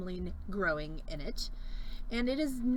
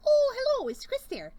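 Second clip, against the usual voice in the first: a higher, louder mock Midwestern mom greeting; roommate's mom on the phone; 6 dB louder than usual. Host imitating her roommate's mom asking for Chris. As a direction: chirp the hello like a cheery mom calling.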